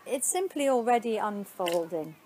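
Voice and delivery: ordinary talk, nothing marked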